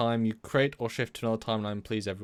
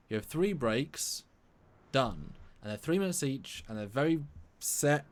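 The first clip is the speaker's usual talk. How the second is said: usual speaking voice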